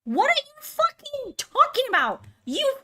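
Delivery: high-pitched incredulous yell; exasperated outburst. Real, not acted. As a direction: voice leaps up in outrage. Outraged at co-player's insistence.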